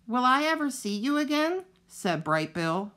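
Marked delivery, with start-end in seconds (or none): 0.1-1.6 put-on higher young voice, close to tears; a son asking, near crying; light child goose, a touch teary; young goose, tearful. A narrator's reading. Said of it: "wiping his eyes".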